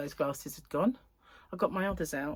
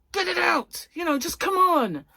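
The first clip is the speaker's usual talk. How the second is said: higher, louder mock urging; impatient urging; 10 semitones higher, 5 dB louder than usual. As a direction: hurry them up, impatiently. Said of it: "And it was like".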